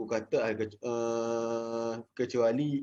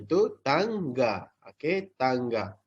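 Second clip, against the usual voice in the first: slow modelling; slower than usual. Shown as a demonstration. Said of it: "teacher modelling the word".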